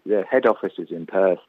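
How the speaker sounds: ordinary talk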